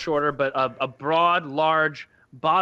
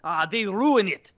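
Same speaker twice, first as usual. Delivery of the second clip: put-on much higher, fretting Eastern European accent; the Bosnian repairman upset at the damage; agitated Eastern European accent; 8 semitones higher, 4 dB louder than usual. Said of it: "that Eastern European accent".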